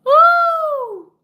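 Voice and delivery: high, loud whooping cheer; celebratory woo. Real, not acted. Cheering about her new title, loud whoop. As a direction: whoop it out in triumph.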